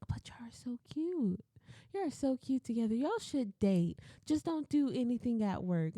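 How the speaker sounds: mock matchmaker's high coo; matchmaking gush. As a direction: imitate a manager fawning over a cute couple. Manager teasing coworkers as a couple.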